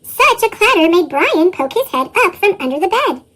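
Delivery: ordinary delivery, nothing marked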